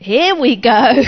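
loud, drawn-out aside of delight; acted-out inward cheer; gleeful anticipation. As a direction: stretch it out loudly, relishing the opening. Acts out a gleeful inward thought.

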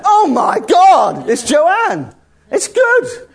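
drawn-out, playful "modern person" amazement; mock awestruck exclamation. Preacher imitating someone amazed at a friend, hammed-up excitement. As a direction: gash over someone with hammed-up awe. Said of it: "That's a modern thing person might say".

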